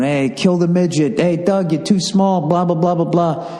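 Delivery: high mocking heckle; heckling drunk fan.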